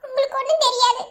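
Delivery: high, weepy protest; tearful wife answering her husband; crying. Acted. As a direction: push the words through sobs. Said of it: "அழாம பேசாம இருடி".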